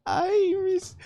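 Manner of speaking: a light wail from a weeping ghost; weeping ghost woman. Acted. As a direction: cry out for lost children, drawn out. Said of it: "and she says".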